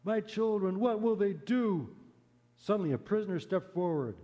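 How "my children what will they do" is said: high, slow desperate plea; anguished plea. Acted. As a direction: beg in anguish for the children. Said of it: "he cried out".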